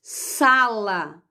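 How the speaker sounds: ordinary delivery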